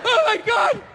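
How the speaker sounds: high, strained complaint; miserable dieter. Acted. Starving dieter turning down cake, high miserable whine.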